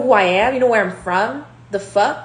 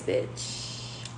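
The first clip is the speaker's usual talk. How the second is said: breathy whisper, much softer, tossed off; whispered aside; 14 dB quieter than usual. Real, not acted. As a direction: whisper it under your breath as an afterthought. Whispered aside after a boast.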